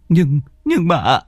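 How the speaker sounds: high, slow stammering protest; scared boy. Acted. Thắng hesitating before old Hỷ, high scared stammer. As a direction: falter in fear.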